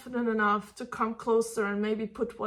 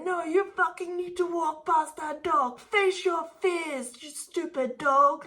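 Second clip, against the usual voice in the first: mock frustrated owner scolding, pitched up; hammed-up nagging at a scared dog; impatient owner; 4 semitones higher than usual. Owner forcing dog past another dog, mock scolding. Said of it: "keep arguing with your dog and saying".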